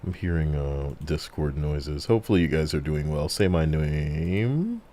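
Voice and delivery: usual speaking voice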